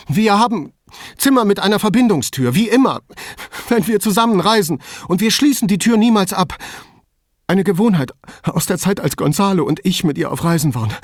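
high, loud, panicky explanation; agitated rambling. A narrator's reading. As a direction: babble on anxiously, easing a little towards the end. Distraught caller rambles on. (aufgeregt und ängstlich)

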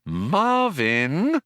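high, drawn-out warning; warning with a rising lilt. A narrator's reading. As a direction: stretch the name as a caution. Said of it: "warned Trillian".

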